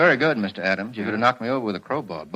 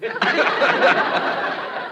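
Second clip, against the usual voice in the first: a high, breathy burst of laughter; 13 semitones higher than usual. Real, not acted. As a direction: crack up at the answer. Host laughs at the joke answer.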